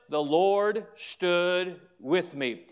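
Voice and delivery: slow, deliberate proclamation; stretched-out, weighty verse; emphatic scripture quote. Acted. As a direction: declare it word by word. Preacher closes on the verse.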